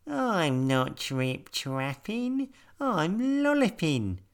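high, slow bouncy rabbit. A narrator's reading. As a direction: speak like a little rabbit. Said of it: "said a creature with long ears".

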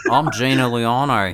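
drawn-out Australian impression of a reality star; slow, broad Australian vowels; Australian accent. Cohost demonstrates how Gina says her own name, Australian accent. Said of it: "do an Australian accent".

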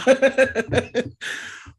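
sudden high burst of laughter; laughing. Real, not acted.

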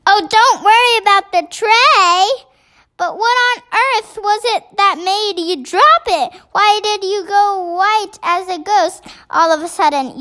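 high, insistent questioning; exasperated, high-pitched queen; agitated queen. A narrator's reading. Queen impatiently questions the maid.

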